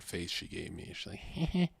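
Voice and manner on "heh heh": soft, high fake giggle; mock a movie-style titter; little put-on giggle, pitched up; imitated coy giggle. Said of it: "laugh like it's, like, a movie".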